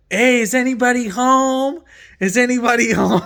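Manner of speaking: high, loud call into the house; retold yell. Stranger calling into the house, loud yell. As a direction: shout it like a stranger at the door. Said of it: "this guy yelling".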